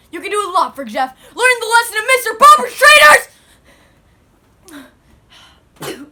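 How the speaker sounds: loud frantic tyrant rant; ranting shout. Acted. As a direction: rant at the subjects.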